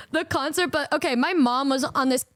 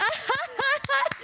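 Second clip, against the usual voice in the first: high imitated cackle; high, staccato mock laugh; imitating mom's laugh; 10 semitones higher, 3 dB quieter than usual. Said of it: "her laugh is so distinct".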